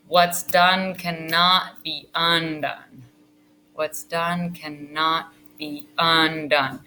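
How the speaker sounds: very slow, each word weighted, "done" falling; held pause. Shown as a demonstration. Teacher showing how the actor holds the line with a pause. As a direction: hold it, let it land. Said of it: "the actor is supposed to hold it with a pause".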